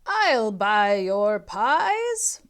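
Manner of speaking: lightly voiced old man, loud and slow; a hint of a gruff old buyer, drawn out. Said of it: "said an old man".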